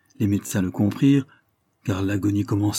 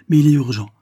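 urgent; 6 semitones higher, 8 dB louder, faster than usual. A narrator's reading. Carlyle urges her to leave.

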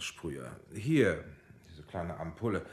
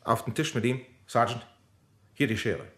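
brisk orders; 5 semitones higher, 6 dB louder than usual. Acted. Doctor orders sergeant to act.

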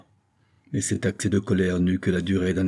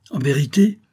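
loud, higher-pitched exasperation; exasperated; 6 semitones higher, 5 dB louder than usual. A narrator's reading. Vicomte rounding on his deceitful mistress, exasperated. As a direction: burst out, exasperated. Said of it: "poursuivit monsieur de la Morlière exaspéré".

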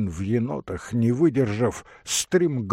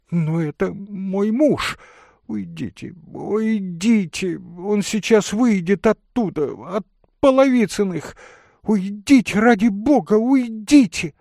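high-pitched urgent plea, growing louder; frantic pleading; 11 semitones higher, 5 dB louder than usual. Acted. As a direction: beg him to go before the husband comes. Lady begs him to leave. (испуганная мольба высоким голосом)

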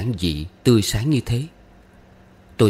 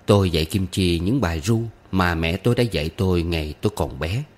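ordinary delivery, nothing marked

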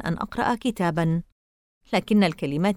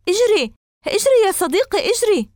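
urgent shout; 13 semitones higher, 6 dB louder than usual. A narrator's reading. Pushing the dog, urging.

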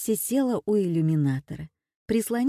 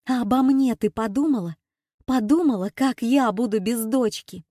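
higher, louder and fast accusing cry; angry shout; 5 semitones higher, 5 dB louder than usual. A narrator's reading. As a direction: throw the blame at her. Sister shouting in quarrel. (гневный крик, выше, громче и быстрее)

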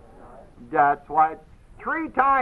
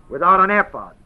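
"Without" leaned on, falling at the end; "Without" stressed, the rest light; 4 dB louder than usual. Shown as a demonstration. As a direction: lean on "Without" then let it drop. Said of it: "That's how it's said".

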